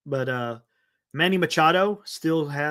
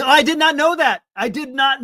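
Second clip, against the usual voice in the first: higher and louder, astonished; surprised; 7 semitones higher, 6 dB louder than usual. Real, not acted. Streamer repeating a fact from chat, loud astonished. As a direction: say it like you just can't believe it.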